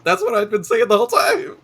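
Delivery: loud and fast, laughing through it. Real, not acted. Defending the catchphrase to a friend, loud laughing.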